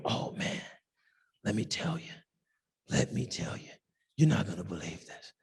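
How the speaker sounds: hushed, low conspiratorial whisper; a gossiper whispering big news. Retold or imitated. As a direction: lean in like sharing a juicy secret. Imitating gossiper sharing juicy news.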